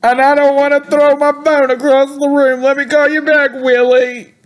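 high-pitched mock meltdown; put-on upset. Caller too upset to stay on with Willie, mock meltdown. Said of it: "I'm so upset".

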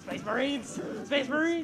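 high, loud rallying shout; mock battle cry. Teammate rallying the Space Marines, mock battle cry. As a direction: holler it like a playful war cry.

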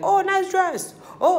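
high, loud bubbly small talk; mock chirpy compliment. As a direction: gush like a party stranger. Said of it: "stand up and be like".

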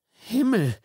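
put-on high, shocked young voice; delivery boy repeating in shock; shaken teen. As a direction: gasp it out, still stunned. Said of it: "wiederholte der Pizzabote".

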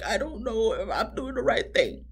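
high, soft imitation of tearful whimpering; mock dramatic crying. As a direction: mimic her weepy confession. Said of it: "She was crying dramatically".

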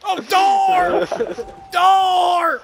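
loud, high-pitched shout; calling out. Acted. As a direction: yell a warning across the battlefield. Calling out in game.

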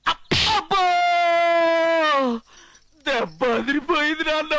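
high, drawn-out yowl; howl of pain. Acted. Son hurt, complaining to father, howling in pain. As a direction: cry out high as the blow smarts. Son howls after being hit.

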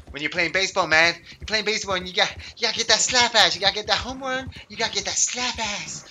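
high, loud, hyped-up baseball jock; hammed-up, excitable sketch impression; sketch ballplayer. Imitates the Slap Ass sketch.

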